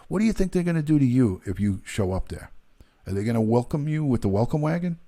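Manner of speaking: ordinary talk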